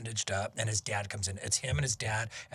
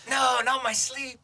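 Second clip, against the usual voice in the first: mock protest; 12 semitones higher, 5 dB louder, slower than usual. Joking about dying.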